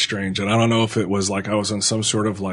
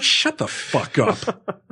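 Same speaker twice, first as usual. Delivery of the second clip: high, drawn-out mock outrage; mock angry retort; 9 semitones higher, much slower than usual. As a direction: stretch it out slowly, pitch way up. Imagined retort to scolding nurse.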